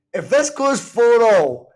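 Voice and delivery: high and loud, drawn out slowly; excited. Real, not acted. Fan watching a late comeback, high loud excitement. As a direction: surge of hope as the match swings.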